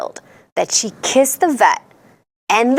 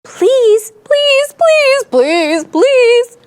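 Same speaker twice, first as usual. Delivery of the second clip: high, loud, repeated pleading; storybook crowd pleading at high pitch; begging; 11 semitones higher, 5 dB louder, much slower than usual. A narrator's reading. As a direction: beg desperately, hammed up for kids. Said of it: "People begged".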